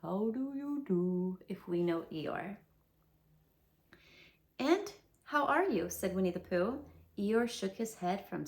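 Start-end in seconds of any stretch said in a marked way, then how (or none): 0.0-1.4 slow, drooping Eeyore sigh of a greeting; gloomy Eeyore greeting. Acted. Eeyore mopes a greeting to Pooh, slow and gloomy. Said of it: "it was more of a". 4.6-5.9 cheerful Pooh. Acted.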